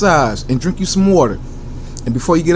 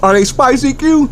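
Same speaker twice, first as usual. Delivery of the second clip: higher interviewer voice asking himself; high questioning voice; 9 semitones higher, 3 dB louder than usual. Pretend. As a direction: play the interviewer, pitched up.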